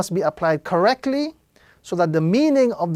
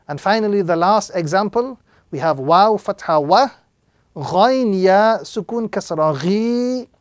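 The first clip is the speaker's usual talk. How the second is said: ordinary talk, nothing marked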